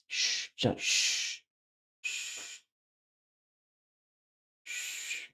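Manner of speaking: soft, drawn-out "shh"; posed hushing, all breath; secretive shushing. Pretend. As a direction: hush someone as if sharing a secret. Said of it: "Shushing secret finger to lips".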